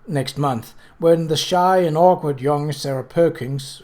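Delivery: usual speaking voice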